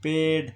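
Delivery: पेड said loud as paid, the long e stretched; word said out clearly. Shown as a demonstration. Teacher sounding out पेड to find the vowel. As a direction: call out पेड loudly so the vowel is heard. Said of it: "vowel की आवाज़ कहाँ पर आ रही है".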